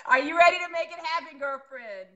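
practiced host hype. Acted. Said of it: "I've been practicing".

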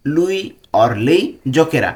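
Lui or lei giocherà drawn out word by word, very slowly; slow dictation. Shown as a demonstration. Teacher repeats it slowly for Bengali learners, careful pace. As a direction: spell it out slowly for the class. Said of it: "সে খেলবে।".

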